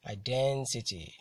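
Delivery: slow teacher's demo of "identity", stressed iDENtity; stress on DEN. Shown as a demonstration. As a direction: say "identity" slowly, leaning on the DEN. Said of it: "I T Y are usually stressed".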